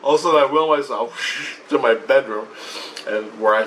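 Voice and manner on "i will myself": ordinary delivery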